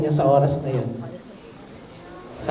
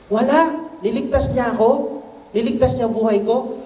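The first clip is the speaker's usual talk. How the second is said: surprised; 6 semitones higher than usual. A narrator's reading. King shocked at no reward.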